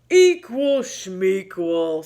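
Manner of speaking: slow, drawn-out scoffing bear voice; dismissive bear. Acted. Bear scoffing at Mouse about fair teams, slow and drawn out. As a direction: brush it off like a careless bear. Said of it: "said Bear".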